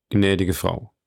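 serious, restrained address; grave tone. A narrator's reading. Monte Cristo addresses the weeping young woman, gravely. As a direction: address her soberly and earnestly. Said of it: "sagte mit ernstem Ton Monte Cristo".

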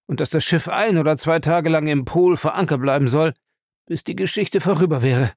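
high, hurried character voice; urgent confession, pitched well up; frightened captive confessing. Acted. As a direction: spill the whole plan anxiously. Lacey spilling the whole plan.

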